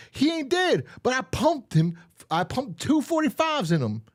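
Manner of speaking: very high, loud hammed-up squeak; killer voice. One killer telling the other about the shot, in a high squeaky voice. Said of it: "he goes".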